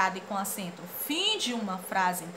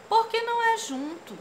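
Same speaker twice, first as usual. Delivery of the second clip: high answering voice; 11 semitones higher, 3 dB louder than usual. Acted. Teacher voicing the answerer in the comic strip.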